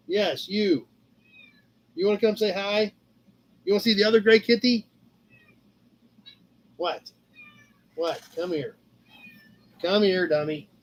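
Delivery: soft, quiet pet talk to a cat; softly calling the cat over; coaxing the cat. Real, not acted. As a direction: coax the kitty over gently. Coaxing the cat onto camera.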